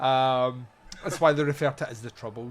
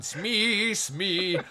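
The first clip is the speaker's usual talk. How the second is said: Dustin Hoffman in Hook; 6 semitones higher than usual. Retold or imitated. Ranting captain hunting a ticking clock, mock shouting. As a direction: call out with drawn-out vowels. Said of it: "run about the place shouting".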